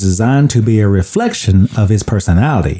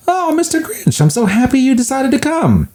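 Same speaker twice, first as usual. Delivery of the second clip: high, gushing greeting; excited mom voice; 13 semitones higher than usual. Retold or imitated. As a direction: greet the guest sweetly, way up high.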